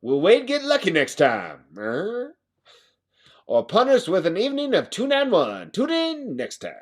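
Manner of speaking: put-on old radio announcer teasing next episode; old-timey radio voice. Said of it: "Old-timey radio voice".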